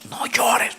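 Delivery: whispered, barely audible. Acted. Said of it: "me ha dicho".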